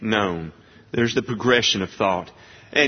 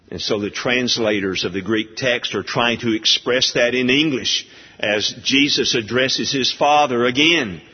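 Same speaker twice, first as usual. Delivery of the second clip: ordinary delivery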